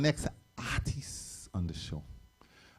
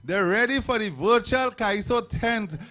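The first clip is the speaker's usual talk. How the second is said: hype call; 7 semitones higher, 6 dB louder than usual. Real, not acted. MC hypes crowd on stage.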